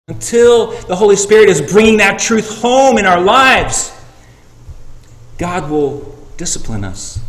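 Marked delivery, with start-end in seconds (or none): 0.1-3.9 loud, high, driving sermon delivery; fiery preaching. Acted. Preacher urging the church to share the gospel, loud and fervent. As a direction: preach it hard to the congregation.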